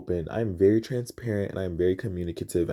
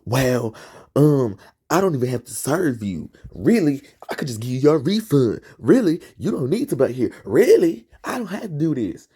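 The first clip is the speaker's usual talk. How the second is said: lightly mimicking a dismissive manager, raised pitch; haughty mock manager voice, a touch higher; mock snooty manager; 5 semitones higher, 4 dB louder than usual. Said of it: "she's like".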